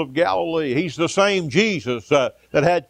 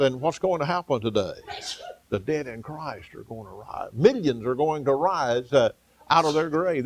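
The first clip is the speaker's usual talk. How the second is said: ordinary talk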